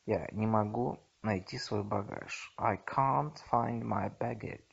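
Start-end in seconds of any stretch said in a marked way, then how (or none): none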